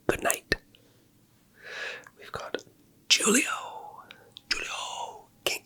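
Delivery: soft breathy ASMR whisper. Acted. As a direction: drop into a gentle whisper. ASMR whisper name reading.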